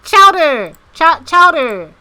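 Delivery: "chowder" loud and high with a drawn-out r; stuttered, exaggerated "chowder" in mock-American pronunciation; chowder pronunciation demo. Shown as a demonstration. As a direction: practise "chowder" loudly, copying the recording.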